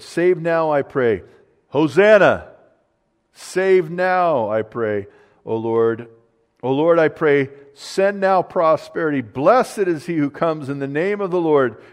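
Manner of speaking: loud, ringing proclamation of the psalm; preaching at full force. Acted. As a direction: cry it out to the whole church.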